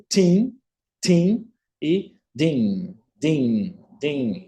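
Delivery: "tim" unvoiced, then a slow, buzzing "din"; t versus d drill. Shown as a demonstration. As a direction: hand on throat, feel "din" vibrate. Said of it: "Você coloca a mão na garganta". ("tim" sem vibração, "din" com vibração)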